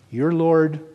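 ordinary talk

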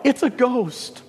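high, startled cry of fear; frightened cry. Acted. Terrified disciples in the boat cry out in fear. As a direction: cry out like scared sailors seeing a ghost. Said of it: "cry out".

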